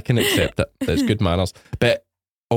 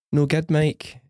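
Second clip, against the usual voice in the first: put-on Fife accent; 7 semitones higher, much slower than usual. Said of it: "She's got a Fife accent".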